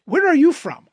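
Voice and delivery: high, eager greeting; bright friendly question. Retold or imitated. Host acting out meeting a stranger, high and eager. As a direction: ask it brightly and curious. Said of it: "and you say".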